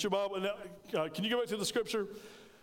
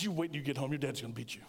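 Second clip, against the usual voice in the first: imitated mom's low warning; low-pitched mock-menacing parent; 7 semitones lower, faster than usual. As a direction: drop the pitch low and warn the child. Mother threatening child after whipping.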